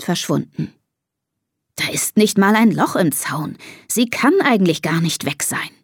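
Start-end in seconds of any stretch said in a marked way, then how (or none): none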